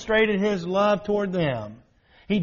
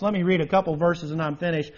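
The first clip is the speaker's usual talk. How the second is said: normal talking voice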